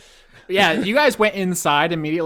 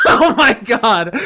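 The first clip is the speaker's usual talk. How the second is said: laughing; 7 semitones higher, 7 dB louder, slower than usual. Real, not acted. Laughing at the joke.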